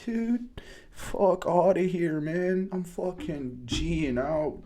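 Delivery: high hyped-up outburst; buzzing over a tiny win; excited. Real, not acted. Thirty cent crypto profit.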